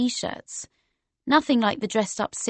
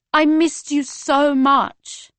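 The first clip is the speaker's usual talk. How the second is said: slow, high, loud cry; telling a friend over loud music, shouting slowly; 6 semitones higher, 7 dB louder, much slower than usual. Acted. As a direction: call each word out slowly so he hears. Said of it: "I said".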